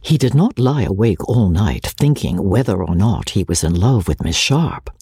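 plain reading voice

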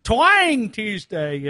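playful country drawl on the segment name; twang. Pretend. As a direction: stretch out a playful Southern twang, slowly. Naming the twangy bumper segment.

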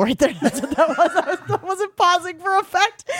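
high laughing embarrassment. Real, not acted.

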